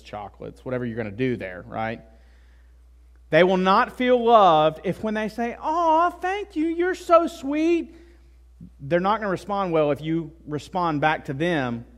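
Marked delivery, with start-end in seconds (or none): 5.6-7.9 high, drawn-out touched cooing; high-pitched grateful gush; gushing sweetheart. Acted. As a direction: act out a wife delighted by a gift. Voicing a touched wife.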